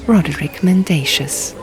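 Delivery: plain reading voice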